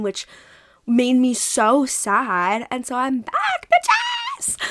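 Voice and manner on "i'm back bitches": shouted, much higher on the last word; excited shout. Real, not acted. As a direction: yell it like a triumphant comeback. Triumphant return announcement.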